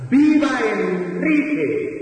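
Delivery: high, slow triumphant cheer; jubilant cry. Acted. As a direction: ring it out like a victorious crowd. Victors shouting for Enrique.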